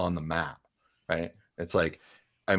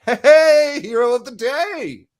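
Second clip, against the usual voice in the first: much higher, louder hearty hail; bossy merchant boss; 7 dB louder than usual. Acted. Lenine greets K O from across the busy wagon yard, loud and high. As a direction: call out to the hero across the yard. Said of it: "she's like".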